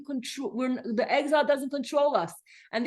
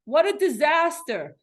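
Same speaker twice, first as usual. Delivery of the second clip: higher, louder dramatized grumble; mock complaining; 7 semitones higher, 4 dB louder than usual. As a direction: play the fed-up complainer, over the top. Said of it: "You could say".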